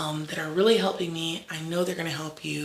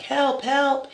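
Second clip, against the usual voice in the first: high, louder call of a drowning person; mock cry for help; 9 semitones higher, 5 dB louder than usual. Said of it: "They're screaming".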